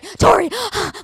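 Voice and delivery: panicked gasp. Retold or imitated. Mother imitated in a panic.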